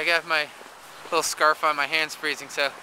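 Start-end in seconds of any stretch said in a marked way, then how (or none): none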